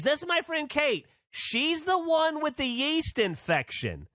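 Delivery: high, slow put-on American lady voice. Introducing her friend to strangers, a high mock American lady. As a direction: announce it brightly like nothing's wrong. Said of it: "She goes".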